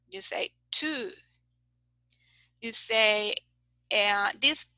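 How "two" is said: "two" with a long, held oo; long vowel. Shown as a demonstration. Teacher gives an example, long vowel in "two". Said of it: "This is longer".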